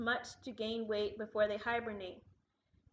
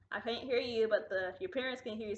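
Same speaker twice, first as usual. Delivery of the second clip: normal talking voice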